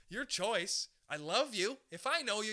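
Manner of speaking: ordinary talk, nothing marked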